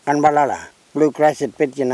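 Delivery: ordinary talk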